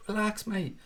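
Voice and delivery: mocking calm-down. Streamer mockingly telling a whining fan to relax. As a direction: tease the moaner gently in a put-on voice. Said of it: "crying about Van Dijk".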